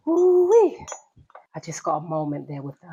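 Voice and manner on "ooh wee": high, loud "ooh-wee" fanning; overheated whoop of relief; hot flush exclaim. Real, not acted. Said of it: "with the hot flush".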